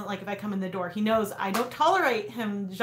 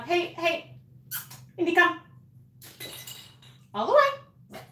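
calling the dog; 8 semitones higher than usual. Real, not acted. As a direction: coax the dog to come all the way.

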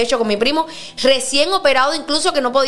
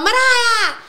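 high called-out name across a crowd; mimicking Mexican fans shouting the name from afar; imitated shouting; 10 semitones higher, much slower than usual. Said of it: "me gritaban".

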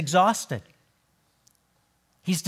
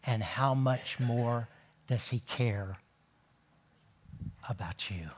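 soft, fading into a whisper on about you; hushed tender question; 11 semitones lower, 12 dB quieter than usual. Retold or imitated. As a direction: let it sink to a whisper. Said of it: "he goes on to say".

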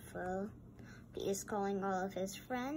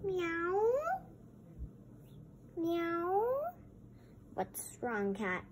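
ordinary delivery, nothing marked

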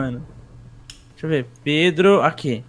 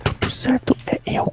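whispering; 3 semitones higher, 8 dB quieter than usual. Acted. Whispering during game.

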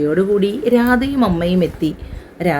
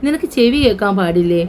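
usual speaking voice

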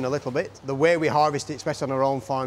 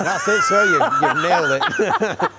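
laughing; 9 semitones higher, 8 dB louder, slower than usual. Real, not acted. Laughing at the Italian attempt.

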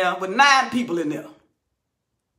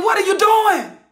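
"What are you doing?" shouted higher, with "doing" falling hard; screaming; 6 semitones higher than usual. Real, not acted. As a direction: scream the question at them. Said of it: "I'm screaming".

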